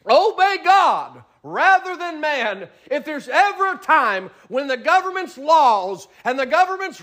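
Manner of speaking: climbing, high, full-force preaching; rising preacher cadence. Acted. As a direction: build the sermon to a peak.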